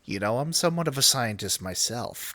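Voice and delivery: lightly voiced film villain quote; Willem Dafoe. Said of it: "the fucking Willem Dafoe's".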